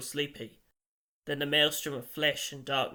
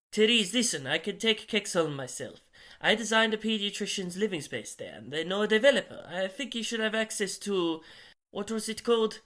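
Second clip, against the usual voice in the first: higher, brisk character voice; assertive dinner host; 6 semitones higher than usual. A narrator's reading. Jesper pitching his plan to Therese, high insistent voice. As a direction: pitch it up and press the point.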